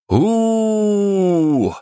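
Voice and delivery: long high roar of a legion; war roar. A narrator's reading. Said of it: "заревели они".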